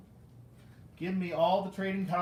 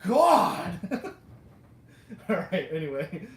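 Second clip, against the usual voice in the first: mock exasperation; 7 dB louder than usual. Collector imagining annoyed recycling workers, loud mock exasperation. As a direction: cry out in mock exasperation, half laughing.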